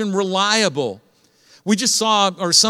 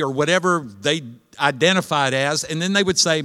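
ordinary talk, nothing marked

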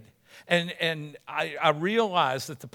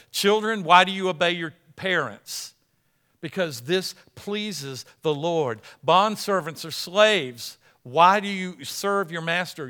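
forceful sermon reading; 3 semitones higher, 7 dB louder than usual. Acted. Preacher reads verses with force.

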